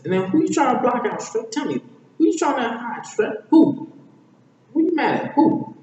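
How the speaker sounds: high, loud, rapid-fire pestering; very high, insistent badgering; Donkey. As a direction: hound them with squeaky questions. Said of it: "Donkey says".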